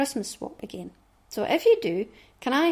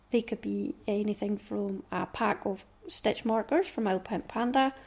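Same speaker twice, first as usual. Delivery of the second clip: normal talking voice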